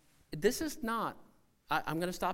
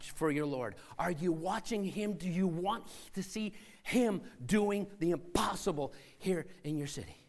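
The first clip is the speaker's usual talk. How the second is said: ordinary talk, nothing marked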